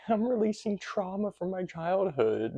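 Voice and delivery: high-pitched, singled-out joke voice; mock announcement. Actor joking about what he never tells people, mock high voice.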